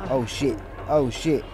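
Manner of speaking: ordinary delivery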